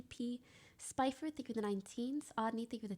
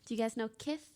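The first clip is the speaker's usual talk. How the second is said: ordinary talk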